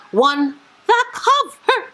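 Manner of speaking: high and slow, savouring each word; gushing. Real, not acted. Booktuber savours praising the cover, high and slow. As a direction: linger on it like it's a treasure.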